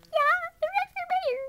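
very high squeaky character. Pretend.

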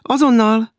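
high, loud, put-on liveliness; feigned briskness. A narrator's reading. Maud answers the knock, falsely brisk. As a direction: call out with fake freshness. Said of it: "felelte tettetett frissességgel a leány".

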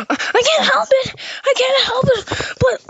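very high, strained squeal; helpless whine. Acted. Smitten fan confessing, helpless squeal. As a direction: squeal it helplessly, twice over. Overwhelmed by crush, whining.

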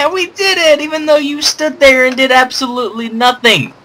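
high, squeaky mock child voice, then sarcastic; a high mock kid voice turning sarcastic; mock kid cheer. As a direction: parrot the kid's cheer in a high put-on voice. Mocking the kid companion's cheer.

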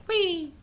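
high, soft squeal; playful whee. Real, not acted. Gamer flung about in the game, high playful whee. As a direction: let out a small high whee.

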